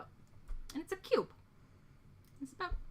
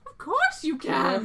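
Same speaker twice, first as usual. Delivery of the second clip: flamboyant shopkeeper; 6 semitones higher, 9 dB louder, slower than usual. Acted. NPC to player.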